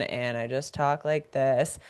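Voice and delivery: monotone. Shown as a demonstration. Showing how a monotone speaker talks, low and flat. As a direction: drone it like you've given up on expression. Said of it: "always been monotone".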